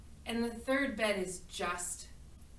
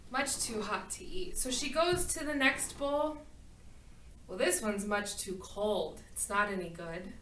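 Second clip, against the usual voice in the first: ordinary delivery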